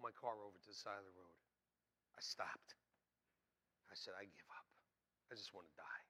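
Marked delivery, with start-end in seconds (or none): 4.2-6.0 low, soft resignation; quiet retold despair; despairing surrender. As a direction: give up quietly, voice dropped. Retelling his despair in the car.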